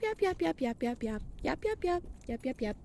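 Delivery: sing-song yap-yap chatter, softening as it goes; imitating nonstop yapping. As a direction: mimic a kid yapping on and on. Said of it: "listen to me yap".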